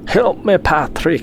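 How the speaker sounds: high, loud pretend cry for help; mock plea.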